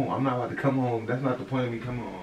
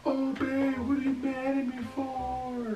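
much higher, slow, sugary mock plea; mock sweet girlfriend; much slower than usual. Boyfriend mocking what he's expected to say, high sweet voice. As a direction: mimic a needy partner, high and drawn out. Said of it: "Supposed to be like".

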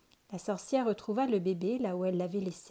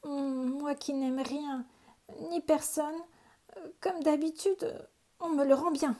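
higher, gruff witch voice muttering to herself; bitter old witch talking to herself; lonely witch sulking aloud in the attic; grumbling old witch; 6 semitones higher than usual. A narrator's reading. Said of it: "elle songea".

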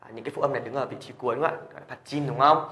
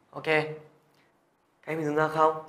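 ordinary talk

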